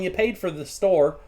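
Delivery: ordinary delivery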